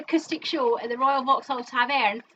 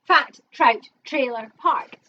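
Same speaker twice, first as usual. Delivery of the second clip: slow, louder caller chant; bingo call; 6 dB louder, slower than usual. Acted.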